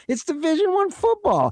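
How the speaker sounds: very high, strained slogan cry; imitating hype call. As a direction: squeal it like a stadium hype man. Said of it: "When you put it at that level".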